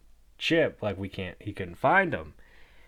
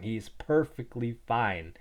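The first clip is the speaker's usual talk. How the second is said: ordinary talk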